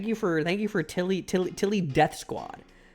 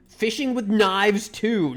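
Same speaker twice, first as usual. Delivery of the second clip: slow, loud, higher title announce; announcing; 6 semitones higher, 4 dB louder, slower than usual. Real, not acted.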